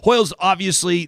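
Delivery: normal talking voice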